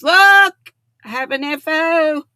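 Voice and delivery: sudden loud high call for attention; eager shout; excited look. Real, not acted. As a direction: burst out to show it off. Excited to show the hat.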